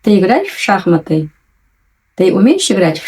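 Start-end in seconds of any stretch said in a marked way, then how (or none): none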